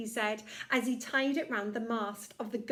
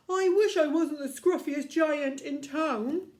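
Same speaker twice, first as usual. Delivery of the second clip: louder, higher voice for the sorry giant; sad giant; 4 semitones higher, 6 dB louder than usual. Acted. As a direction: voice the giant, wishing glumly. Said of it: "he said sadly".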